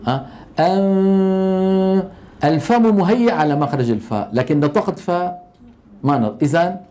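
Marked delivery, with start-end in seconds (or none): 0.6-2.0 أن stretched into a long humming nasal; held ghunna. Shown as a demonstration. Teacher holding the hidden nun for the class, long and humming. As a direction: hold the nasal أن for a long time, mouth set for f. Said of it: "لما بقول أنفسكم".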